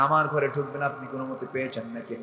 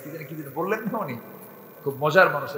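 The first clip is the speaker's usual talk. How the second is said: ordinary talk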